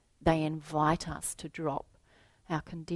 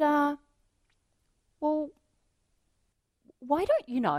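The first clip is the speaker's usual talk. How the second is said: gently curious teacher; 10 semitones higher than usual. Retold or imitated. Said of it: "I said".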